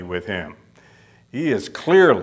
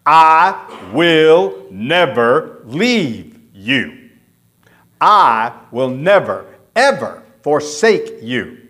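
slow, weighty stress on each word of "I will never leave you"; emphatic promise; 5 dB louder, slower than usual. Acted. Preacher renders God's double negative for the congregation, emphatic. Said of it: "This is the most emphatic statement".

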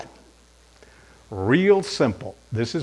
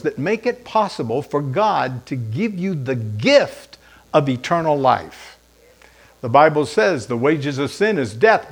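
ordinary delivery

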